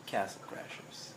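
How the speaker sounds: slow whispered announcement; breathy whisper, drawn out; hushed reveal. Real, not acted. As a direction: whisper the title like a secret. Hushed reveal of a ranked pick.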